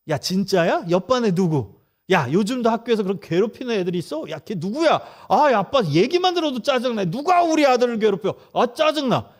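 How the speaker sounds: dad hamming up irritation at the bully for his son; mock annoyed dad. Shown as a demonstration. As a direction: play the dad who gets annoyed on his son's behalf. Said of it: "요게 공감적 추임새예요".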